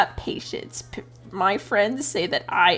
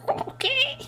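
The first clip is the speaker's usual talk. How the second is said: squeaky mock okay. Retold reaction to college advice.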